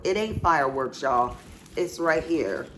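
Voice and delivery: ordinary talk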